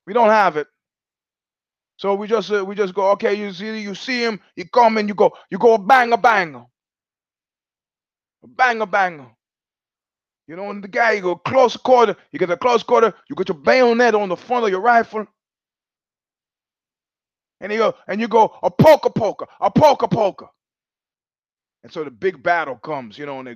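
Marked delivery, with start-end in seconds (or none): 0.1-9.3 mock Italian accent, higher pitched; Italian officer. Officer explains fake rifles in mock Italian accent. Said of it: "he said". 11.1-20.5 mock Italian accent, higher pitched; Italian officer. Officer demonstrates bayonet in mock Italian accent. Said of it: "World War Two joke".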